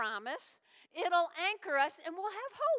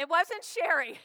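much louder, teasing; playful loud jab; 2 semitones higher, 8 dB louder than usual. Real, not acted. Pastor joking about his wife to congregation, loud teasing. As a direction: blurt out the blame for a laugh.

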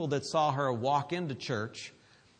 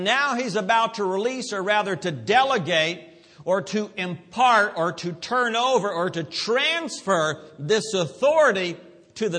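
louder preacher delivery, punching each verb; emphatic preaching; 5 semitones higher, 6 dB louder than usual. Real, not acted. Preacher explains delegated authority to church, emphatic preaching. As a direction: drive each phrase home.

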